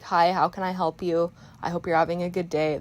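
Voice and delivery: straight-faced, monotone service voice. Shown as a demonstration. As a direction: greet them evenly with no cheer. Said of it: "I was kind of a little more monotone".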